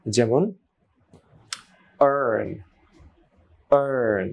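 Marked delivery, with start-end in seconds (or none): 2.0-4.3 "earn" with a narrow, long er; narrowed long vowel. Shown as a demonstration. Teacher models the narrowed mouth, long er. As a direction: hold a half-closed er in "earn". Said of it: "মুখটা একটু চেপে করে".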